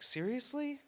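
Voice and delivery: high, incredulous exasperation; exasperated Superman. Retold or imitated. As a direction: lose patience at the crowd. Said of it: "Superman was like".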